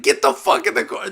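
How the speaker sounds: high, loud, fast yelling right in his face; yelled order. Retold or imitated. Referee yelling at a confused fighter, loud fast bark. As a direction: bark it up close to scare him. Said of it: "went up in his face and he was like".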